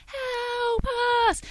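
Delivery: high, soft, drawn-out wail; eerie distant cry. Retold or imitated. Mysterious noise heard in a dark campsite, eerie faint cry. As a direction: cry for help faintly from far away, stretched out high. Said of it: "I could hear the sound".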